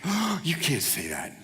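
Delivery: scandalized onlookers; shocked crowd. Retold or imitated. Crowd gasps at Jesus forgiving sins, hushed shock. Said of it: "everybody's like".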